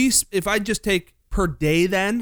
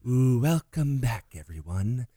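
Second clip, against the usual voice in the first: Delilah voice; 3 semitones lower than usual. Retold or imitated. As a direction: croon it like a late-night host. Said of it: "your Delilah voice".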